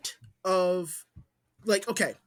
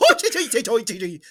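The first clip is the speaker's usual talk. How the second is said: loud, high-starting nonsense babble; hammed-up babble going off the rails; mock Irish gibberish; 5 semitones higher, 6 dB louder than usual. Friend spoofs an Irishman losing it, mock Irish gibberish. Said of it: "completely off the rails".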